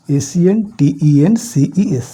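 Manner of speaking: slow, letter-by-letter spelling. Shown as a demonstration. Teacher spelling "sentences" for the class, slowly letter by letter. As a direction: spell it out one letter at a time.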